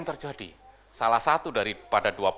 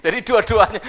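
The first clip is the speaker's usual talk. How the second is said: usual speaking voice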